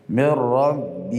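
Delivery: من ربه chanted slowly with a long ending vowel; recitation demo. Shown as a demonstration. Teacher recites من ربه showing the short connection. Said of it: "یه ها را به یا تبدیل".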